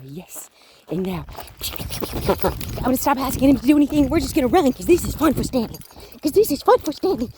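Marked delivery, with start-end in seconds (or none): 2.3-7.3 excited. Real, not acted. Excited praise while running dog.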